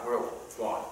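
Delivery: ordinary delivery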